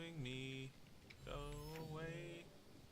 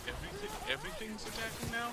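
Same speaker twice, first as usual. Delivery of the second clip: panicked; 12 semitones higher, 9 dB louder, faster than usual. Real, not acted. Overwhelmed by attackers.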